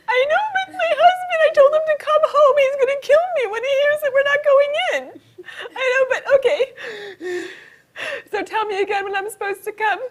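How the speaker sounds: high, squeaky hammed-up sobbing; very high blubbering voice; mock crying. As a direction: play a tearful caller wailing through an apology. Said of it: "sorry about the crying".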